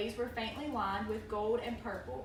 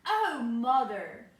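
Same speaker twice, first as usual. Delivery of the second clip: put-on slow, drawn-out child's wonder; hammy stage actor; 4 semitones higher, 5 dB louder, slower than usual. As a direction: stretch every word like a stage child. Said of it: "then said quickly".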